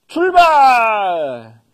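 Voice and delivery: long drawn-out shouted order; loud command. Acted. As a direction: bellow the command to march. Army sets off.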